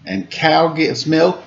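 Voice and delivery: sing-song rhyming picture-book read; reading to children. A narrator's reading. Host reads a farm picture book to kids, lilting. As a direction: lilt the rhymes for little listeners.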